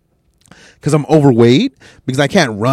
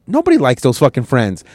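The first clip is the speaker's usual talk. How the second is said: normal talking voice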